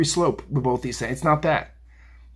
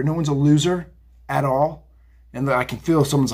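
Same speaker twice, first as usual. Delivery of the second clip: normal talking voice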